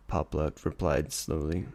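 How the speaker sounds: ordinary delivery, nothing marked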